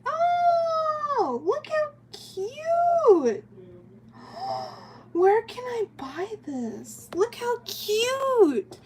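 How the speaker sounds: high cooing adoration of a chibi figure; cutesy gushing. Real, not acted. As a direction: coo over it like a tiny pet. Gushing over a cute figure.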